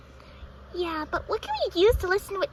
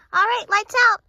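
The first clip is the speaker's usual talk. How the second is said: loud call; 9 dB louder than usual. Acted. Announcing lights out to the room.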